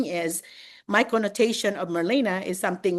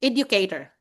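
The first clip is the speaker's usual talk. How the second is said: ordinary talk, nothing marked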